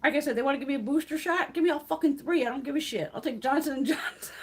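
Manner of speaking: ordinary talk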